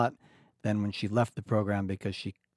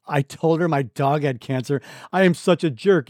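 louder, rising mock groan at oneself; mock self-reproach; 5 semitones higher, 8 dB louder than usual. Imitating a visitor kicking themselves after leaving. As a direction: scold yourself in a hammed-up voice. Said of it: "They're like".

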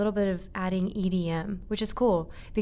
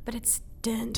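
whispered joking aside; 2 semitones higher, 6 dB quieter than usual. Real, not acted. Agreeing with the host that the book is dense, whispered. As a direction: drop to a breathy whisper.

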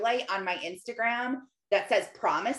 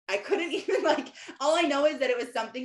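laughing while admitting she forgot; giggling through the confession; 5 semitones higher, faster than usual. Real, not acted. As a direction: let a real laugh break through, words sped up. Laughing at forgetting.

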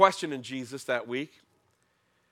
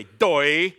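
loud, dopey scornful doy; mocking doy; 9 dB louder than usual. Kids teasing a friend who wasn't listening, loud. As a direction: jeer it at someone not listening. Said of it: "we'd say".

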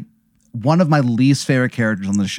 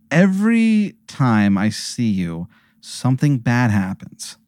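slow, measured grievance after a big sigh; weary confrontation; slower than usual. Acted. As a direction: draw it out with heavy deliberation. Player confronts Gene, sighing first.

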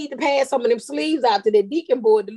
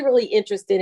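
normal talking voice

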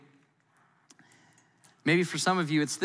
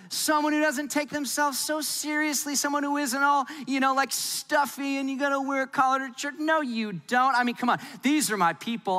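mock rock fan; 7 semitones higher than usual.

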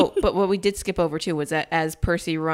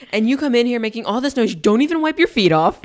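higher, louder nagging mom voice; mock scolding mom; 6 semitones higher, 8 dB louder than usual. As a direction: scold like a fed-up mama bear. Mama bear scolding.

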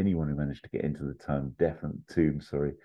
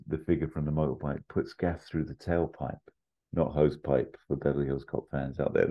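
plain reading voice

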